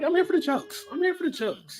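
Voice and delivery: high mock voice. Mocking himself to his co-hosts, a high silly voice. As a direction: pitch it way up, goofy and cheerful. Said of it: "I'm like".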